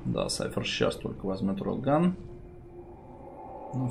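usual speaking voice

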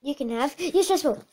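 normal talking voice

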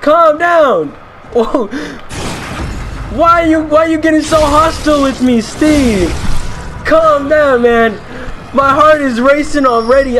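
high, urgent protest to an attacker; panicked pleading. Pretend. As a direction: beg him to calm down, half-panicked. Pleads with hostile game character.

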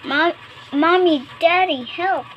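high, loud call; shouted cry for help. A narrator's reading. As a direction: call out loudly to parents for help. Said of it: "shouted, but there was no".

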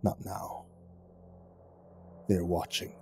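low, soft, secretive; whisper. A narrator's reading. Kaz warning of watchers, whispered low. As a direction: breathe it close to his ear. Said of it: "leaned in close to whisper".

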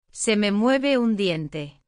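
ordinary delivery

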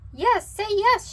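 high, loud, excited cheer; shouting cubs. A narrator's reading. Cubs urging Mama to say yes, loud high cheer. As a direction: shout it out, jumping with joy. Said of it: "shouted the cubs".

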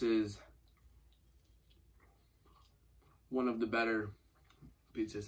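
speaking with mouth full; muffled by food in mouth; chewing while talking. Real, not acted. Seen 0: Bites pizza at 2.0–3.4, then chews with lips pursed while talking.

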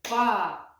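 «Па-у-га» said syllable by syllable, one clap each; clapped syllables. Shown as a demonstration. Teacher clapping out a word's syllables for children. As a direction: break «Па-у-га» into beats. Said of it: "давайте плеснемо слово в долоньки".

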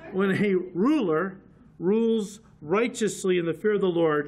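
slowly. Shown as a demonstration. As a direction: spell out ruler rules slowly. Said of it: "let me try that again slowly".

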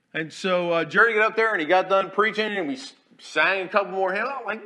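ordinary talk

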